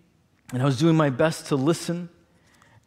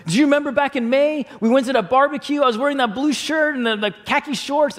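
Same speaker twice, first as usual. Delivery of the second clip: high-pitched lovestruck gushing; hammed-up, high excited chatter; 10 semitones higher, 3 dB louder than usual. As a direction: imitate a friend racing off in giddy reminiscence. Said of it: "I'm just so excited about it".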